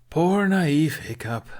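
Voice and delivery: slow, high mock pity; condescending. A narrator's reading. Ruffnut talking down to Hiccup, slow mock pity. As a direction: pity him like a child. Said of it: "shaking her head".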